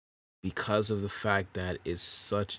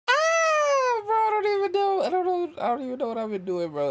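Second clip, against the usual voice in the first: squeaky, much higher, louder cry of helpless frustration; exasperated squeal; 5 dB louder than usual. Real, not acted. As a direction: pitch it way up into a whiny falsetto wail. Asked if he has recorded anything.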